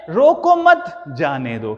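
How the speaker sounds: group रोको मत, then let जाने दो fall; slow reading split after मत; pause after मत. Shown as a demonstration. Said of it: "लेकिन दूसरे सेंटेंस में".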